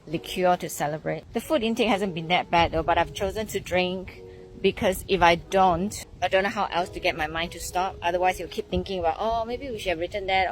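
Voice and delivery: ordinary talk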